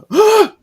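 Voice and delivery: loud high startled gasp; a real gasp of shock; sharp gasp. Real, not acted. Co-host gasping at the comic's spooky line, loud gasp.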